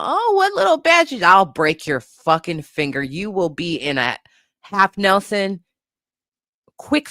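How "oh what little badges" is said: high mocking sibling voice; mocking older brothers. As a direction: tease him like a smug big brother would. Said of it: "they used to try and, you know".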